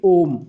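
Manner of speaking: "ओम" with the O held long; extra-long vowel. Shown as a demonstration. Teacher giving pupils an extra-long vowel example, vowel stretched. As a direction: stretch the vowel of "ओम" to triple length. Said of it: "प्लुत स्वर की जो उदाहरण है".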